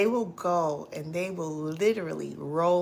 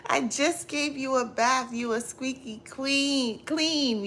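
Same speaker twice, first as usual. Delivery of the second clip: exasperated; 7 semitones higher than usual. Retold or imitated. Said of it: "I'm like".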